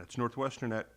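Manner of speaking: speaking through hiccups; words broken by hiccups. Real, not acted. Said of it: "Got the hiccups".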